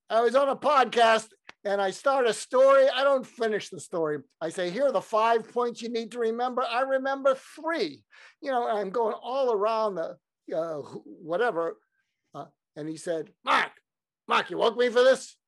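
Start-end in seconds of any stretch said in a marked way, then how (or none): 0.1-10.2 high, worked-up self-reproach; exasperated confession. Acted. Confessing to Larry, acted. 13.5-15.4 put-on high, loud, irritated Brooklyn accent; indignant Brooklyn complaint at being woken; Larry King. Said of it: "in his Brooklyn accent".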